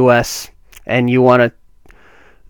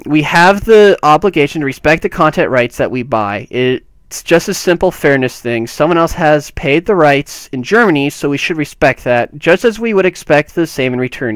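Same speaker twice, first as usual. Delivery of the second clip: raised, emphatic reading of a statement; quote read-aloud; 4 semitones higher than usual. Acted.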